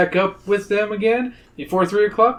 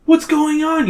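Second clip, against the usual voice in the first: high, bewildered question; alarmed; 12 semitones higher than usual. A narrator's reading. You watching the statue crumble, alarmed. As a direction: let the pitch leap in confusion.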